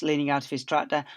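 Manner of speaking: ordinary delivery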